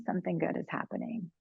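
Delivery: dropped to a soft, quiet repeat; something good is happening said gently under the breath; softly. Shown as a demonstration. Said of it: "Whenever we're feeling".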